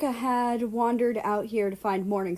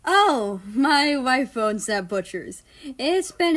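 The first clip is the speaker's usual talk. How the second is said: laughing; 4 semitones higher, 5 dB louder, slower than usual. Acted. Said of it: "Soviet laughed his loud laugh".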